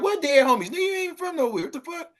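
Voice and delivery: very high-pitched, disbelieving comeback; mock incredulity. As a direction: challenge them in a high, incredulous squeal. Acted comeback to fake gangbangers.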